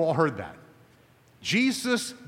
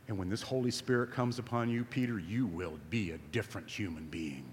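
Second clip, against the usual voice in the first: ordinary talk, nothing marked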